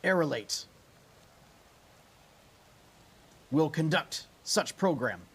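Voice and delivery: cold machine voice over the storm; mechanical voice. Acted. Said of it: "a mechanical voice".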